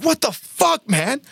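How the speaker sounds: high panicked cry at his friend; freaking out. Retold or imitated. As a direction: voice jumps up in alarm. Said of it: "And he is freaking out".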